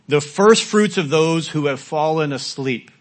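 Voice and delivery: slow, loud solemn reading of the verse; scripture reading. Acted. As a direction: proclaim the verse slowly. Reads scripture verse aloud.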